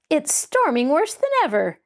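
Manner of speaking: nervous about the storm; uneasy. Acted. Mary uneasily telling the men about the storm. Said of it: "she announced uneasily".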